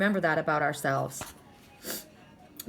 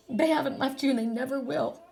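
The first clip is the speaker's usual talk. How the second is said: choked up and higher-pitched; tearful; 4 semitones higher than usual. Real, not acted. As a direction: reassure them through real tears. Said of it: "Sorry, crying again".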